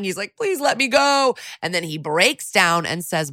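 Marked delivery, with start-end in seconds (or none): 0.4-1.4 mock crying plea. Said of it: "Chet's crying".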